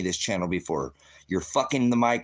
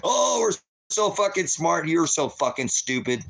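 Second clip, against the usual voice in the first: smug mockery; 7 semitones higher than usual. Mocking smug humans in a rant.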